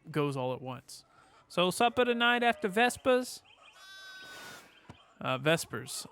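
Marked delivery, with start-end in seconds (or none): 1.5-3.4 higher-pitched mock Italian; badly faked Italian, lifted voice; poor Italian accent. Player voicing a supper invitation, poor Italian accent. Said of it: "try to do a poor Italian accent".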